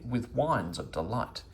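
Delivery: ordinary delivery, nothing marked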